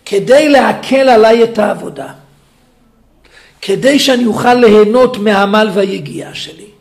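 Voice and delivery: loud, slow, hammering each phrase; full-force preaching. Acted. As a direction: preach it out to the hall.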